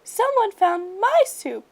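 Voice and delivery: high, slow squeaky cub voice. A narrator's reading. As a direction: squeak like a small bear cub. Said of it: "in her high, squeaky voice".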